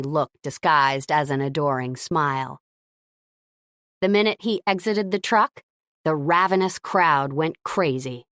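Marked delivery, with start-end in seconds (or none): none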